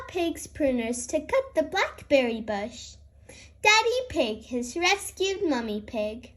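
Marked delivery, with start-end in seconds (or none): none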